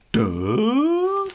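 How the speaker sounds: loud high dopey duh; hammed-up dopey grunt; dumb bully grunt. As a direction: blurt a dim-witted duh.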